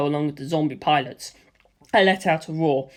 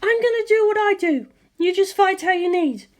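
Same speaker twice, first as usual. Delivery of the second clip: high, bright-voiced young woman, confident; high female character voice, calm and sure; Lisa Lisa's voice; 13 semitones higher than usual. A narrator's reading. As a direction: lift into her high voice and reassure.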